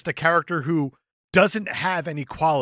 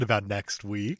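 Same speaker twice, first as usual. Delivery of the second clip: smug; 6 semitones lower, 9 dB quieter than usual. Real, not acted. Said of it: "Why do you sound so smug".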